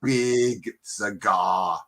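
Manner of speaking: put-on slow, heavy Austrian muscleman accent; slow mock strongman boast; Arnie. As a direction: show off like a bodybuilder, drawing out each word. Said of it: "can you do the audio impression?".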